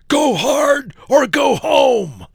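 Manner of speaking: high, bellowing shout, slowed; slow, booming gym-bro shout; bellowing wrestlers. Acted.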